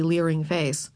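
usual speaking voice

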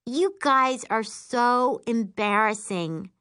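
mock-exasperated embarrassment. Sister scolding cheering family, embarrassed. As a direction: stretch it out like a mortified kid.

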